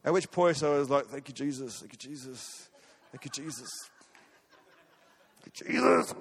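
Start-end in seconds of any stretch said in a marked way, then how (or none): 1.1-3.7 soft, under-the-breath Thank you Jesus; hushed thank you. Retold or imitated. A pastor hearing the clerk's reply, hushed thankful murmur. 5.4-6.1 high, laughing Thank you Jesus. Real, not acted. A pastor laughing to the congregation at the clerk's answer, high laughing thanks.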